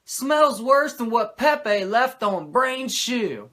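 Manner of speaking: high, loud, slow dopey voice; imitating Mongo. Mongo dropping his nugget of wisdom, high, loud and slow. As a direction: drag it out like a dumb jock, loud and high. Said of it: "Mongo ... says".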